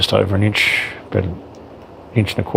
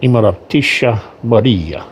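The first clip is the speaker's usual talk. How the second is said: Imperatrice Maria slowed down in a hammed-up Russian accent; slow mock Russian accent; 3 semitones higher, 4 dB louder than usual. Shown as a demonstration. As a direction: Imperatrice said slowly as eem-pe-ra-tee-sha, Russian-style. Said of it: "pardon my good Russian accent".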